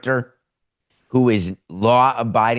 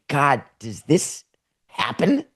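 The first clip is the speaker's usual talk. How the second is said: higher, slow, incredulous question; mock disbelief; 4 semitones higher, slower than usual. Viewer stuck watching a shocking show, incredulous. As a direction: let the question climb in disbelief. Acts a stunned viewer's reaction.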